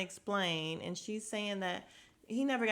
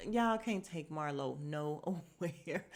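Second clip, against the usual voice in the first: normal talking voice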